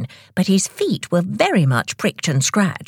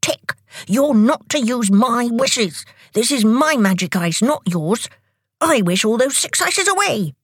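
higher, cross scolding; indignant anger; 7 semitones higher than usual. A narrator's reading. Tock guarding his magic ice from Tick, indignant. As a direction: tell Tick off, pitched up and possessive. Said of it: "in surprise and anger".

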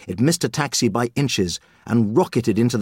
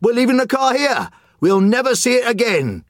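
high, louder and giddy, the words still close to a laugh; raised-voice, almost laughing joy; giddy relief; 12 semitones higher than usual. Acted. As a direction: ring out with carefree glee. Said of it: "He laughed out loud".

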